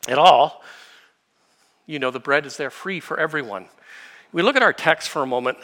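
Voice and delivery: normal talking voice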